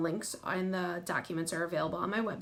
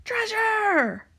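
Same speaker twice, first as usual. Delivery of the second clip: high, loud cheer, word drawn out; excited cry; 14 semitones higher, 6 dB louder than usual. A narrator's reading. Crew cheering for treasure, high excited shout. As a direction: shout it with storybook glee. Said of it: "cries the crew".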